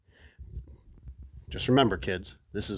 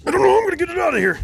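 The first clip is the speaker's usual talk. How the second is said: high fast fret; 4 dB louder, much faster than usual. Real, not acted. Struggling with box.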